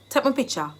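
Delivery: "picture" as Jamaican "pitcha", falling; Jamaican Patois. Shown as a demonstration. Teacher translating a photo request for learners, in Jamaican Patois. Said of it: "I've given you the Jamaican dialect".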